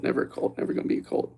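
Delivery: voiceless whisper; conspiratorial whispered repeat; whispered aside. Pretend. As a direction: hush it like a secret. Repeated under breath.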